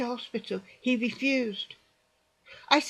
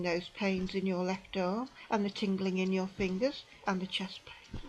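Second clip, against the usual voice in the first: hushed whisper, trailing away; a whispered mock of the paramedic's list; whispered aside; 5 semitones lower, 6 dB quieter than usual. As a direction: whisper it as if scarcely believing. Quoting dismissive paramedic, hushed.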